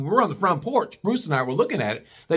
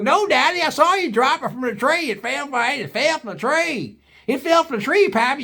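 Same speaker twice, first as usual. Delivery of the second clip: put-on much higher, pleading kid voice; whiny hillbilly son; 11 semitones higher than usual. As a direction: tattle on Daddy like a whiny boy. Son Junior voiced in story.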